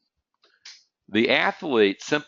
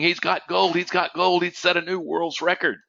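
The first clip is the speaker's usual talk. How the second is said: imitating race announcers, higher and thrilled; excited announcers; 8 semitones higher than usual. Announcers calling the winning hurdler's gold, mock excited. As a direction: call the finish like a hyped commentator. And all the announcers are going.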